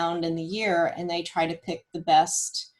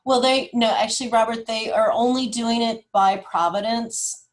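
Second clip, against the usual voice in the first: emphatic correction; 4 semitones higher, 6 dB louder than usual. Real, not acted. Correcting Robert, raised voice.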